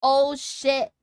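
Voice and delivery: ordinary delivery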